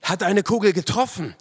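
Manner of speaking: high, loud, anxious question; alarmed cry. A narrator's reading. Narrator asking fallen friend about the bullet, alarmed high cry. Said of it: "rief ich".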